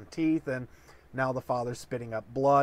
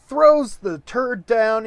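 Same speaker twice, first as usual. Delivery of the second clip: ordinary delivery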